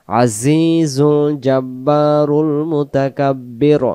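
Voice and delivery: slow melodic tajweed chant; Quran recitation. Shown as a demonstration. Teacher chanting the verse for students, slow and melodic. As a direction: draw out each vowel in recitation.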